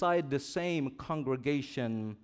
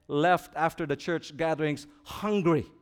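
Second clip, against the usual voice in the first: ordinary talk, nothing marked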